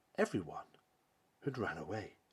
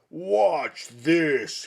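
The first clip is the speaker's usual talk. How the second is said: huge booming giant roar, much higher and louder; roaring monster; 7 semitones higher, 11 dB louder than usual. Acted. Greedy Fossifu showing off to the forest, booming roar. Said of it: "he roared".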